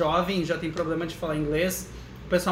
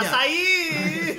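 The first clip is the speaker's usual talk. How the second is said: high, laughing cry of a food name; laughing mock shout; 9 semitones higher than usual. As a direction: shout it as a joke insult, cracking up. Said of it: "falar assim". (grito de brincadeira rindo)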